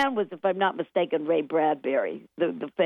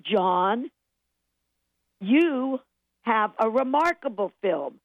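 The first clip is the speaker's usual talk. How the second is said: rising, building praise; 5 semitones higher than usual. Retold or imitated. Film man praising the director at a screening, voice going up and up. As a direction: voice climbing higher, drawn-out proclamation. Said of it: "The voice kept going up".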